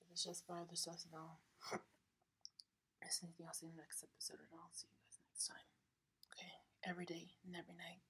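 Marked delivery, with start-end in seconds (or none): 3.0-8.0 hushed whisper to close the episode; whispered sign-off. Real, not acted. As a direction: whisper goodbye so no one hears.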